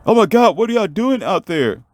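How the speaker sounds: high-pitched exclamation of alarm; alarmed outcry. Acted. Parent at the river to the children, high alarmed outcry. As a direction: cry out, pitched well up.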